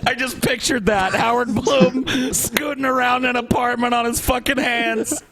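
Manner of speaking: high-pitched, talking through laughter; laughing. Real, not acted. Picturing Howard walking on his hands, laughing through it. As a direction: crack up while getting the words out.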